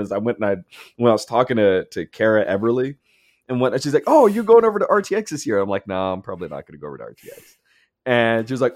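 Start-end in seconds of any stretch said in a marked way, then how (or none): 4.1-5.6 bright quoted question. Retold or imitated. Quoting a friend's question. 5.9-7.6 quiet mumbled reply. Retold or imitated. Quoting own reply.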